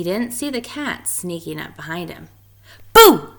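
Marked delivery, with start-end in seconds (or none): 2.9-3.3 loud, high startling boo; shouted boo. Acted. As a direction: jump out and shout to scare him. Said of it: "cried Spike".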